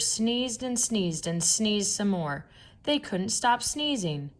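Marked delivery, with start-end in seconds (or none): none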